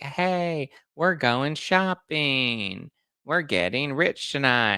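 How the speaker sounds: hammed-up reality star bragging; mock celebrity gushing. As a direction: stretch out the shopping line like a cheesy promo. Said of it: "They were just like".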